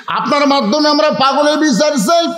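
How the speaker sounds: high, chanted demand; crowd's demand. Acted. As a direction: demand justice on a high, sustained pitch. Worshippers repeat plea more forcefully. (উঁচু সুরে বিচারের দাবি)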